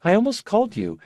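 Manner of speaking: lightly voiced sick young woman, high; airy, distracted girlish voice; high fragile young woman. Rebus voiced by narrator.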